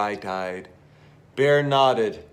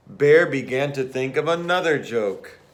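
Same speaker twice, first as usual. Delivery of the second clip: plain reading voice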